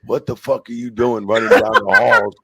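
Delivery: angry agent. Retold or imitated. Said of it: "calls from the fucking agents going".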